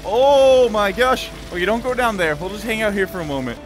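usual speaking voice

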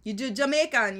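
lilting Jamaican accent. Shown as a demonstration. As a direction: shift your tone into Jamaican. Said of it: "The Jamaican is easy".

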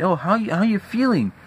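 sing-song mimicry of the annoying caller; mocking neighbor. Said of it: "He gonna say".

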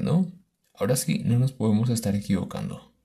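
ordinary delivery

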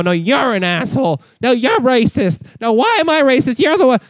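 rising, squabbling rally voices; whiny mock shouting match; mock protester argument. Acting out rally protesters arguing.

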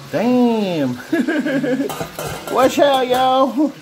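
ordinary talk

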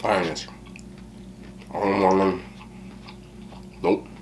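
talking with food in mouth; words between bites; chewing while talking. Real, not acted. Seen 1: Took a bite at -1.0; closed-lip jaw movement between words.

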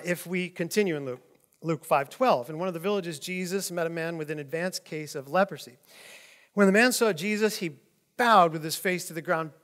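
usual speaking voice

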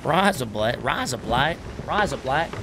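Raza Blight drawled with broad Australian vowels; repeating Raza Blight in mock Aussie; Aussie accent. Streamer doing his Aussie accent for chat. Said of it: "doing accents while yelling at the crew".

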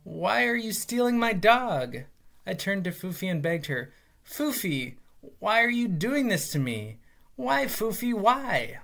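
begging the dog through retold tears; a child's hammed-up plea to his dog; mock tearful pleading. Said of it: "I started crying".

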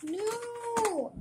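high, wailed no; dismayed cry. Real, not acted. Dismayed reaction while playing.

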